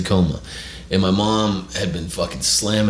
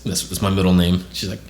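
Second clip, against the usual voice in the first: plain reading voice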